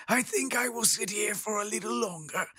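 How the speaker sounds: a hint of a character voice. NPC voice reply.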